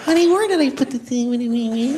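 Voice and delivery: high, thin and whiny; pinched reedy whine. Retold or imitated. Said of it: "that annoying, reedy thing".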